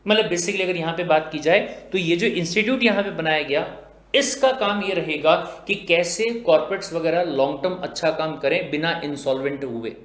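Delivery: usual speaking voice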